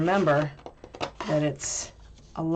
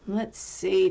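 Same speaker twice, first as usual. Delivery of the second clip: ordinary talk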